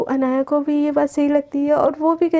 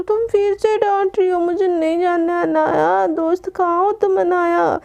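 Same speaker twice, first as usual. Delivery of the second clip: put-on drunk whining; 4 semitones higher than usual. Drunk Garima wailing for her friend Anaya. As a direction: complain like a drunk girl calling her friend.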